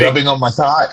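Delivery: low, deliberate delivery of a catchphrase; demonstrated recitation. Shown as a demonstration. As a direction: recite it low, as the correct version. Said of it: "It goes like this".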